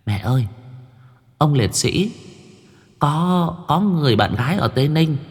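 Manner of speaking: put-on high childlike voice, eager to tell; a little girl telling her mother a secret; high child's voice pleading with mother; young girl. Young daughter whispers to mother.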